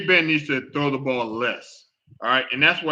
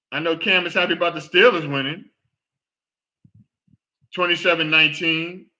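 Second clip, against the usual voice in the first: normal talking voice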